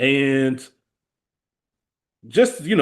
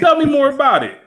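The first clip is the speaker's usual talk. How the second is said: much higher, louder teasing voice; Spike Lee; 14 semitones higher, 6 dB louder than usual. Retold or imitated. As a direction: needle him with exaggerated delight. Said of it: "Spike Lee would've been like".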